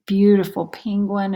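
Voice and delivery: ordinary delivery, nothing marked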